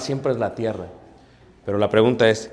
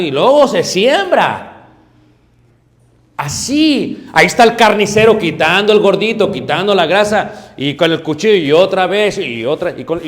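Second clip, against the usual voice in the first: higher, louder, driving sermon voice; predicación a todo pulmón; 3 semitones higher, 7 dB louder than usual. Acted. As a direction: preach it out hard to the congregation. Pastor preaching at full force. (predicando con fuerza, más agudo y más fuerte)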